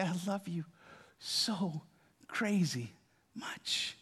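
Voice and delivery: soft, fading to a breath; tender whisper. Pretend. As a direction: hold them and say it gently. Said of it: "wants to wrap his arms around you and say".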